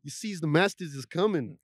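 mock old-time Southern urging; hammed-up historical impression; Harriet Tubman. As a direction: put on a caricatured plantation-era voice. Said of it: "How do you think Harriet Tubman talked?".

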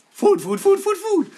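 high-pitched, repetitive animal chant; high little animal voice; food-obsessed animal. Acted. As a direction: squeak it out like a creature begging for food. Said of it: "do they walk around going".

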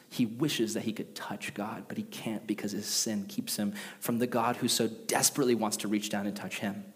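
breathy, intimate whisper; hushed whisper. Real, not acted. Pastor whispering to the congregation about God's longing. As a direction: drop to a whisper to draw them in.

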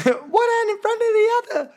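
very high mimicked film line; high squeaky impression. Friend redoing David Beckham's one line, squeaky high voice. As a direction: imitate it way up at the top of the voice. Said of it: "I can't even go that high".

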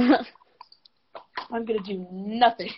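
very slow, each word stretched; slow, satisfied declaration of laziness; slow drawn-out vow. Retold or imitated. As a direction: drag it out like you're already lazy. Retold decision to rest.

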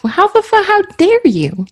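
high, affronted outburst; playfully outraged challenge; mock indignation. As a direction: act scandalised, pitching the voice up. Friend acts out indignation.

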